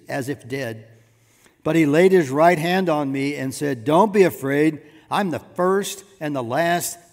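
ringing pulpit reading, hard on key words; forceful scripture reading. Acted. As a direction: proclaim the verses with building force. Reads Revelation aloud with preaching force.

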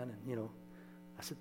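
normal talking voice

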